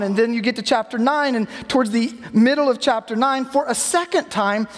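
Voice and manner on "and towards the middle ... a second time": ordinary delivery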